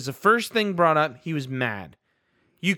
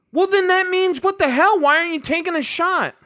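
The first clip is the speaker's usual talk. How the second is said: much higher and louder, incredulous; exasperated outburst; 11 semitones higher, 4 dB louder than usual. Real, not acted. Host venting at the coach's call, exasperated shout. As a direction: throw up your hands, what the hell.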